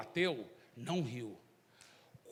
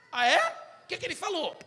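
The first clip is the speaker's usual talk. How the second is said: mocking question; 11 semitones higher, 6 dB louder than usual. President mocks Zé.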